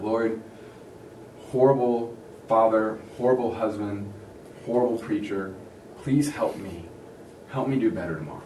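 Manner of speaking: low, subdued confession to God; understated guilty pleading; remorseful prayer. Retold or imitated. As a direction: pray haltingly, with pauses, as if ashamed.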